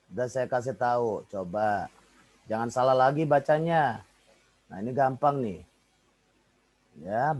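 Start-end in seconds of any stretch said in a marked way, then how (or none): none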